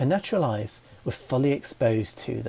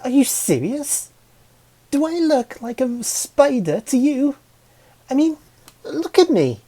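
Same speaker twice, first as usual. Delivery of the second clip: exasperated; 13 semitones higher, 5 dB louder than usual. Acted. Nix offended by flowers.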